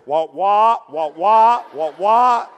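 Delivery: muffled loud trombone-like wah wah; Charlie Brown teacher. Retold or imitated. Preacher mimicking the Peanuts teacher's muffled wah wah. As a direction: imitate the muted horn teacher voice. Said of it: "all I hear is".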